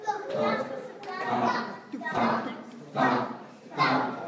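heavy, punched stair thumps; stomping thumps. A narrator's reading. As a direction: stamp out each thump like footsteps.

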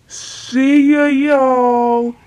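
high, loud sign-off shout; loud goodbye. Pretend. Kid signing off to the viewers, shouting. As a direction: belt out the farewell.

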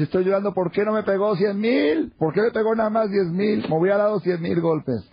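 acted high-pitched lament, pitched well above normal; tearful wail. Weeping father explains his tears to his children, high tearful lament. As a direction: wail it in mock despair, high and insistent. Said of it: "¿por qué estás llorando, papá?".

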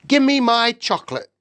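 put-on high, loud boy's demand. A narrator's reading. Boy to the witch wanting his chocolate, demanding.

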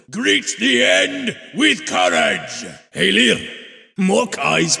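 high shouted command; battle shout. Acted. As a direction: fire up the troops before the charge. Battle exhortation to troops.

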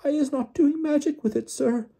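extremely high elf squeak; tiny, piercingly high protest; Winky squealing. A narrator's reading. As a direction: squeal a frightened denial. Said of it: "squealed Winky".

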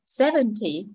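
Seventy as SEV-en-tee, falling off; Seventy with the first syllable prominent; stress on first syllable. Shown as a demonstration. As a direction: put the weight on the start of Seventy. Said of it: "nhấn trọng âm số một".

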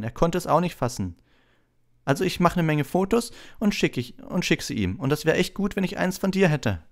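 put-on high-pitched gushing boy; excited young admirer, high voice; enthusiastic Colin. A narrator's reading. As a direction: chatter on in high, thrilled fan mode. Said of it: "holte vor Begeisterung tief Luft und sagte".

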